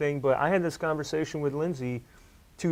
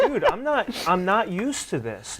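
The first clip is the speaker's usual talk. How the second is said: high-pitched retold protest; mock disbelief; 7 semitones higher than usual. Athlete recalling first struggles in business, in high-pitched disbelief. Retelling own baffled reaction to struggling.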